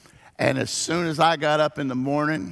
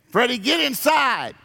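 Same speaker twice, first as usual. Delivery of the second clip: high firm call; mom calling in; 9 semitones higher than usual. Retold or imitated. Mom calling her son inside, high firm call. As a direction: call the kid in sharply. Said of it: "my mom would say".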